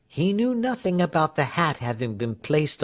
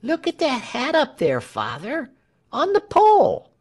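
put-on high-pitched boy calling to his father; child pointing something out, rising high and loud; excited boy; 11 semitones higher, 4 dB louder than usual. A narrator's reading. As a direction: pipe up like an eager child, very high. Young Walter calls to his father.

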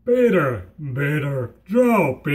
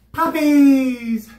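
gleeful shout; 6 semitones higher than usual. Pretend. Ironic contrast after bad news.

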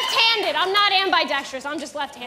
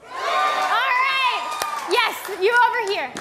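ordinary talk, nothing marked

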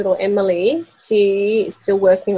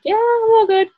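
high, carefree and content; lightly acted contented voice; breezy satisfaction; 8 semitones higher than usual. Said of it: "now it's like".

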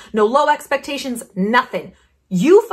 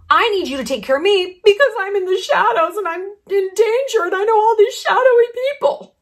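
whiny plea; 9 semitones higher, 3 dB louder than usual. Retold or imitated. Swindler's reversed plea, whiny.

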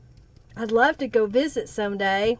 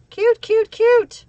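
excited; 9 semitones higher, 6 dB louder, slower than usual. Real, not acted. Delighted repeating of the word.

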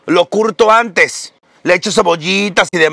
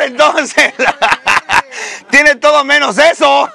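normal talking voice

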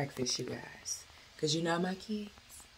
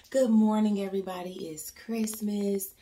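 normal talking voice

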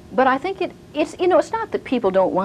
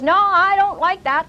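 louder, higher, slow objection; mock objection; 6 semitones higher, 4 dB louder than usual. Board member objecting, raised and slow. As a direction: George pipes up to object at the table, loud and slow.

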